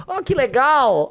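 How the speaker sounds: high, slow mock delight; sarcastic. Real, not acted. Sneering at the poll numbers, sarcastic mock delight.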